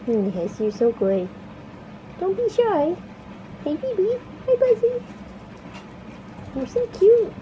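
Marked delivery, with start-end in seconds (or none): 2.2-5.0 high cooing pet voice. Real, not acted. Cooing at pet guinea pig. 6.6-7.3 high, louder cooing. Real, not acted. Cooing at pet.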